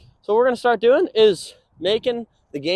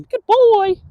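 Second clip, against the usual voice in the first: high, bright praise to the dog; 14 semitones higher, 8 dB louder than usual. Real, not acted. Trainer praising his dog, high-pitched. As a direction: cheer the dog on, pitched well up.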